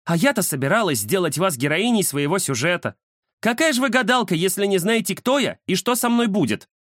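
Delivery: high-pitched affronted client voice; indignant journalist. Acted. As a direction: scold the fortune-teller in a high voice. (возмущённая журналистка, высокий голос)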